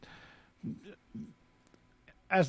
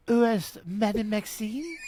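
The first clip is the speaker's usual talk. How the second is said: slow, higher, hammed-up French girl voice; girl's French accent; 3 semitones higher, slower than usual. Podcast host reads the French girl's line in a mock French accent. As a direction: ham up a schoolgirl's French, laughing through it. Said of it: "He's gotta do a girl's French accent".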